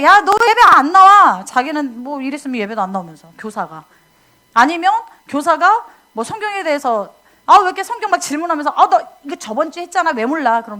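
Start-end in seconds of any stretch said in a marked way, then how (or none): 0.0-1.4 slow loud high scold; scolding teacher. Retold or imitated. Church teacher scolding child for skipping worship, slow loud scold. 7.4-10.6 quick exasperated huffing; irritated teacher. Retold or imitated. Teacher snapping at student asking about Bible, exasperated.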